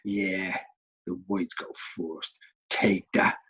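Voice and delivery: low, soft gloating voice; mock chess player. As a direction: gloat quietly over the chessboard. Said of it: "walked past chess and went".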